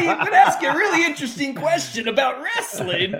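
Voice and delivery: a hint of a goofy wrestling host; Trey Rowland. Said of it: "you sound like Trey Rowland".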